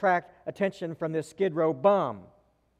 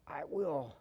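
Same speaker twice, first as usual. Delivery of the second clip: a much softer, lower, choked little answer; mother, overcome, answering the old man in a choked whisper-soft voice; choked-up reply; 4 semitones lower, 11 dB quieter than usual. Retold or imitated. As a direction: barely get it out past a lump in the throat. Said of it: "from a throat that contained a stone".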